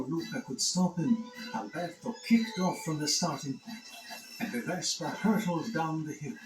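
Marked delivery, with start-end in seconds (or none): none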